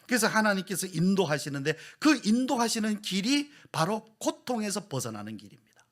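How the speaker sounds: loud, high, impassioned sermon delivery; preaching at full force. Acted. Pastor pressing the congregation, loud and impassioned. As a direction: drive the point home to the pews. Pastor proclaims God's guidance.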